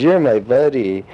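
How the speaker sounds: drawn-out child's voice, lightly put on; little kid. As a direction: mimic a kid claiming a buddy. Retells a little boy claiming him.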